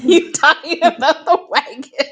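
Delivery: loud, high, through laughter; laughing outburst. Real, not acted. Podcaster teasing co-host about the wagon, laughing loudly. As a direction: burst out laughing as you say it.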